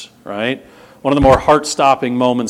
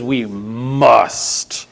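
breathy whispered We must, weighted on We; hushed emphatic must. Shown as a demonstration. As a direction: whisper it like a warning. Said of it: "no, no, he says".

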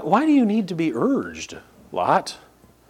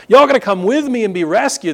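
higher, louder prophet's call of doom; urgent warning; 7 semitones higher, 4 dB louder than usual. Retold or imitated. Lot warning his sons-in-law, higher and louder urgent plea. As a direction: warn them that judgment is coming. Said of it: "or is about to be son-in-laws".

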